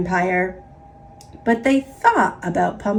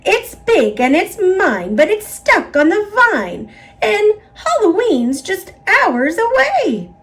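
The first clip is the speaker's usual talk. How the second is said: witch's voice climbing higher; rising witch voice; 9 semitones higher, 4 dB louder than usual. A narrator's reading. Witch tells the others the pumpkin is hers, voice climbing. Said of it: "said the witch".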